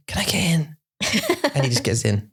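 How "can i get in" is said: imitating child. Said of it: "Mason just goes".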